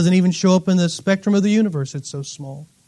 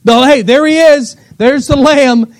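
higher, louder cry of discovery; excited calling out; 7 semitones higher, 7 dB louder than usual. Acted. John pointing his audience to the Lamb, excited call. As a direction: call out to a crowd as you spot him.

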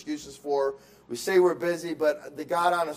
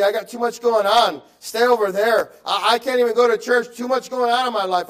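a whiny, self-important hard-times excuse, pitched higher; mock excuse-maker; 5 semitones higher, 7 dB louder than usual. Preacher mocking a churchgoer who quits in hard times, louder and higher. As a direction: brush God off like someone too busy.

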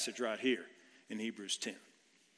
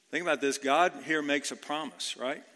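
ordinary talk

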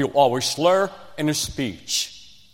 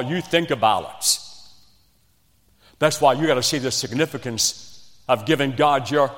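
ordinary talk, nothing marked

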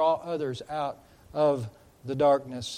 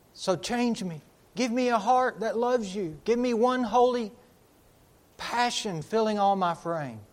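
rising, pleading cry to the Lord; fervent prayer; 5 semitones higher, 4 dB louder than usual. Acted. Preacher voicing a believer praying to Jesus, pleading. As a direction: beg for change with a swelling voice.

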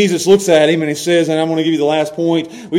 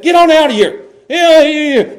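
higher, louder country shoo; shooing yell; 8 semitones higher, 4 dB louder than usual. Retold or imitated. Preacher voicing Jesus shooing Peter like a dog, loud shooing yell. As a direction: chase him off like a dog in the yard. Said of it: "that's how we would have said it".

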